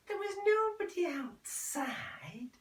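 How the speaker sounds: slow hushed whisper. Acted. Mother confiding to son in a slow whisper. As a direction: breathe it out secretly.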